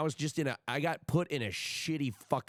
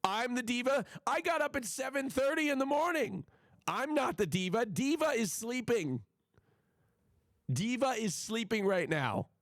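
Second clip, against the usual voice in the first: indignant; 8 semitones higher than usual. Real, not acted. Outraged at being called diva.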